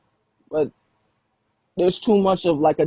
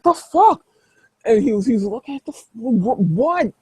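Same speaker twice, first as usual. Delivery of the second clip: high, stammering and bewildered; bewildered guy; 5 semitones higher than usual. Acted. The guy backing off from the werewolf, high stammering disbelief.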